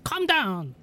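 a hint of brushing her off; lightly voiced sneering knight; condescending knight. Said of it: "He's like".

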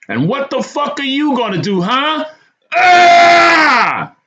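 crazed, high-pitched scream at full volume. A narrator's reading. Crazy man pins a terrified tenant to the wall, screaming. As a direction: scream it high, ending in a long yell. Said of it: "screaming at the top of his lungs".

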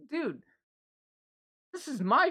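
high-pitched indignant protest; retold freak-out. Answering the coworker about his food, retold indignant protest. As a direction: retell protesting, voice shooting up. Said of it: "why are you freaking out".

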